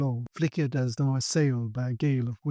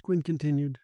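usual speaking voice

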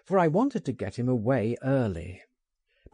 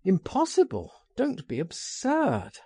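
brisk retort; 7 semitones higher than usual. Acted. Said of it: "I retorted".

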